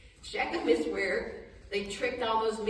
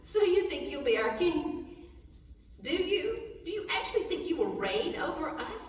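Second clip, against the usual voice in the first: mocking brothers; 7 semitones higher than usual. Brothers mocking Joseph's dream.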